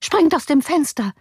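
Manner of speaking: high, urgent nurse's voice; drängende Amme. A narrator's reading. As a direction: urge him desperately to escape. Amme drängt zur Flucht. (aufgeregt drängend)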